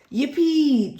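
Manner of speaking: high, loud yippee; cheering Peppa. A narrator's reading. Peppa cheering that friends will come, excited yippee. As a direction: cheer with a child's delight. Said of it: "cheers Peppa".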